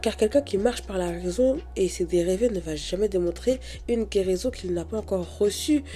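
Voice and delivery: normal talking voice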